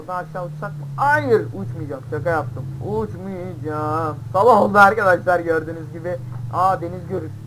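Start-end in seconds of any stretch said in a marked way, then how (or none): 1.0-2.0 mock loud refusal. Refuses to jump off cliff. 2.9-4.2 slow drawn-out refusal. Pretend. Refuses slowly after joke. 4.3-6.2 loud cheerful call to the viewers; loud announcement. Real, not acted.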